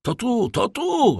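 high, drawn-out call; high character cry from outside; voice behind the door. A narrator's reading. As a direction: call out a discovery, slow and high. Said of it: "rozległ się głos za drzwiami".

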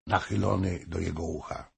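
plain reading voice

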